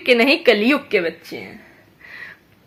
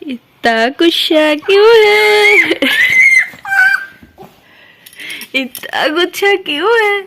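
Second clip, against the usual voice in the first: slow, louder teasing question; mock-serious teasing; 9 semitones higher, 4 dB louder than usual. Real, not acted. Mother teasing her angry toddler, slow mock concern. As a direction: draw it out like pretend concern.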